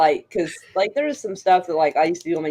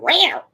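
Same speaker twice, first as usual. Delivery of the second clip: a high, rising little meow; imitated cat meow; 11 semitones higher than usual. Nurse's surprise meow from the hallway, high and rising. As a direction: mimic the odd cat sound heard through the door. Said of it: "like, a weird sound".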